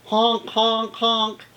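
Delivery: loud honking horn; bus horn. Acted. Reading of city traffic aloud, loud bus-horn honks. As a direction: honk like a passing bus. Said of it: "A bus drives by".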